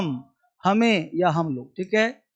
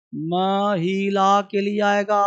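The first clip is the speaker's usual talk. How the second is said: slow drilled answer; much slower than usual. Shown as a demonstration. Teacher drilling the class on the meaning, slow and emphatic. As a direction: stretch out "महिला के लिए आएगा" slowly. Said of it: "drilling the answer".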